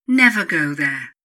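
loud, emphatic never; stern warning. Acted. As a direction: bang the word down like a final order. Stern warning against misuse.

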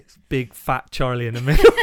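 laughing at himself, loud; self-mocking with a laugh. Real, not acted.

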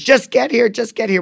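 high, insistent retold demand; combative. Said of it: "literally combative with the nine one one".

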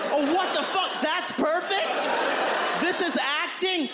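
very high, outraged disbelief; incredulous. Retold or imitated. Shoved actor questioning the director's praise, high incredulous squeal. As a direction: squeal the questions in mock bewilderment, drawn out.

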